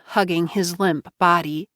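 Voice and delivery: plain reading voice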